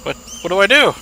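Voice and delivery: mock panic.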